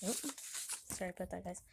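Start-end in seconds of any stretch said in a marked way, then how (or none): none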